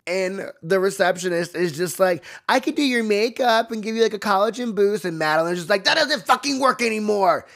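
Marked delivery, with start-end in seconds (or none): 2.5-5.0 higher-pitched mock receptionist. Receptionist offering Madeline a treatment, high mock voice. Voicing a perky receptionist in retelling. 5.8-7.5 high, mock-irate outburst; pressed, exasperated. Madeline rejecting the receptionist's offer, high mock exasperation. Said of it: "So pressed".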